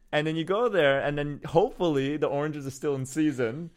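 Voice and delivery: ordinary talk, nothing marked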